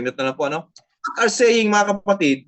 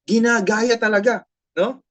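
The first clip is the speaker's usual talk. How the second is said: usual speaking voice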